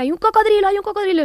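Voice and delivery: high-pitched, loud retold cries of alarm; imitating a son's night-terror shouting; imitated child's scream. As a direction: yell it like a child seeing things at night. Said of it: "se puso a gritar".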